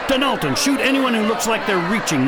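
stern shouted order to the crowd; commanding order. Acted. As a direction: lock the room down with authority. Commander locks down the building.

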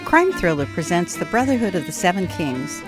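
ordinary delivery

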